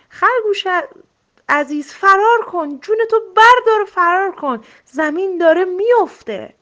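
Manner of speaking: high, loud urgent call to run; alarmed warning. A narrator's reading. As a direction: shout the alarm at a friend. Rabbit warns to flee.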